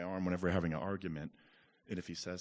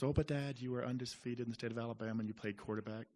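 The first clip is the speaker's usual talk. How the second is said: higher-pitched voice of a protesting son; son objecting; 6 semitones higher than usual. Retold or imitated. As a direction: lightly act out a kid countering Dad. Father voices his young son's reply.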